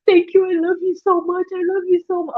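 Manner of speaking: high-pitched imitation of gushing, tearful thanks; mock crying. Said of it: "coming out over here crying and saying".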